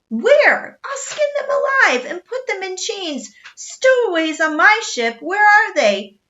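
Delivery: loud, high raging captain; furious roar. A narrator's reading. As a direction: rage and threaten at full volume. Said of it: "he exploded again".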